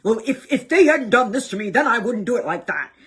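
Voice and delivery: a self-mocking tangle of excuses; mock rationalizing. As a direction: parody your own justifying. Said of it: "we don't have to go down the rabbit hole".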